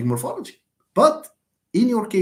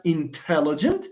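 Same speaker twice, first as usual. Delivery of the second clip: "intelligent" stressed on second syllable TEL; "intelligent" with TEL leaned on, left hanging. Shown as a demonstration. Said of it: "and stresses this".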